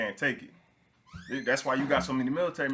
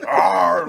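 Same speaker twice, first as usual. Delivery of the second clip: loud laughing mock roar; T-Rex roar; 4 semitones higher, 11 dB louder than usual. Retelling the shipmate stomping around, roaring through laughter. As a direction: growl it out like a dinosaur, cracking up. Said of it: "he stomping around the galley".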